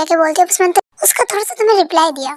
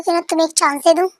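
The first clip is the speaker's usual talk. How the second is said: usual speaking voice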